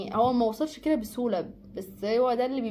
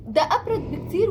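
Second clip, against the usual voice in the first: emphatic; 8 semitones higher, 6 dB louder than usual. Real, not acted. Emphatic point on coldness.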